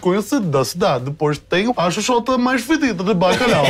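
Porto accent, hammed-up gaja; Portuguese accent. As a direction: European Portuguese accent, comic. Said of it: "nascido na cidade de Porto".

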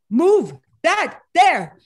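loud, high, each word slow and punched; emphatic command. Retold or imitated. Baba insists she move the boulder, emphatic. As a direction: imitate a teacher's slow, forceful order. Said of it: "he was so emphatic".

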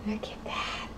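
hushed gasp of wonder; excited whisper. Real, not acted. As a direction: breathe it out in awe.

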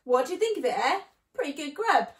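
loud, delighted showing-off; gleeful cry. A narrator's reading. Mister Fox dancing up and down, crying out gleefully. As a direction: show off the haul loudly and proudly. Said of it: "cried Mister Fox, dancing up and down".

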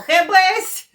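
loud, high burst for a shock ending; mock dramatic bang. Vlogger mimicking a shock finale for viewers, explosive exclamation.

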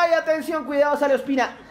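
high-pitched urgent warning; startled, pitch leaping up; sudden alarm. Real, not acted. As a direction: cry out a warning mid-play. Warning as keeper rushes out.

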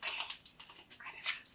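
slow breathy whisper. Shown as a demonstration. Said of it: "repeats the phrase".

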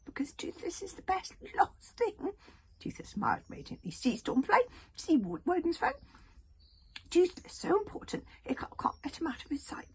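high whispery boastful dragon; little dragon voice. Acted. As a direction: puff it up like a proud tiny dragon. Toothless dragon voice.

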